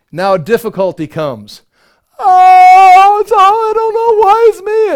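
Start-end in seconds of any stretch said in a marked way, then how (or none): 2.2-4.9 loud, high theatrical wail; hammed-up woe-is-me howl; mock moaning. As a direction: wail in exaggerated self-pity. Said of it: "don't start moaning".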